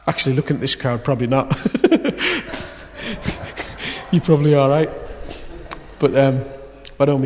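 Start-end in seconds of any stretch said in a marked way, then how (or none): 1.6-2.7 short high burst of laughter. Real, not acted. Preacher laughing at his joke about the crowd, a high laugh. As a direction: laugh at your own joke.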